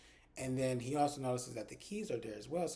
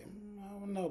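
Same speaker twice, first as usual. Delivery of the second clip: mock reluctant and unsure; hesitant; 8 semitones higher than usual. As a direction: hem and haw a little. Reluctant friend at first unsure.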